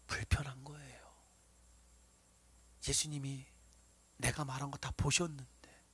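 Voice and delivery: soft, low whisper of discomfort. Acted. Preacher whispering to the congregation, hushed. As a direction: lean in and breathe it to the congregation.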